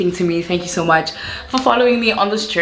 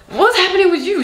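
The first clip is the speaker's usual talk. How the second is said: squealing high mock greeting; high-pitched surprised hello; Levi's greeting; 12 semitones higher, 3 dB louder than usual. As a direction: imitate a friend's shrieking hello. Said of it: "Levi's like".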